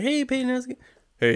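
high, sing-song teasing parent; knowing parent voice. Retold or imitated. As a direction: parent who knows what you watched. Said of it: "your parents are like".

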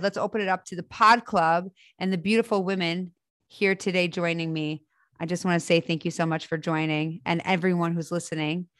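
ordinary talk

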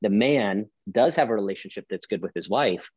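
ordinary talk

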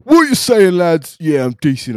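high, loud put-on lad voice. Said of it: "Ah, fucking hell, I can't do it".

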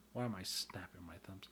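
soft unvoiced whisper; whispered to self. Real, not acted. Vlogger whispering a puzzled question to himself. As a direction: mutter it under the breath.

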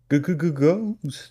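high, drawn-out cartoon stammer; hammed-up ghost scare; mock scared stutter. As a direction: stutter into a long, high wail of fake fright.

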